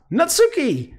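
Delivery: high-pitched call to someone behind a door. Acted. Calling to Natsuki through a door.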